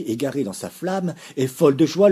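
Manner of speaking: plain reading voice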